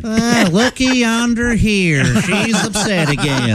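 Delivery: high, slow Southern drawl; hammed-up Southern teasing; Southern accent. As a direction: needle them in a put-on Southern twang. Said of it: "speak in a Southern accent".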